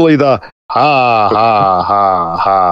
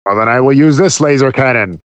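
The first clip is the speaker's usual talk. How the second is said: giant alien booming voice; 3 semitones lower than usual. Acted. Villain threat and laugh.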